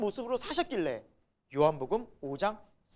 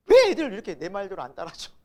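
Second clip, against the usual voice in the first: louder, mock exasperated complaint; retold exasperation; 7 dB louder than usual. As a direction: re-enact a parent's annoyance at being disobeyed. Voicing his own past irritation. (짜증 섞인 불평을 흉내 내듯)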